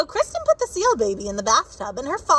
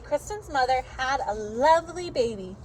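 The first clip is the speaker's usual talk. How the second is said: usual speaking voice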